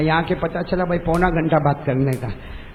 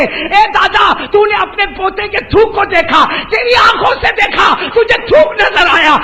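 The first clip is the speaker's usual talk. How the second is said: put-on proclaiming old monk; 12 semitones higher, 6 dB louder than usual. Old monk proclaims to the grandfather, shouting. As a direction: announce it like a revelation.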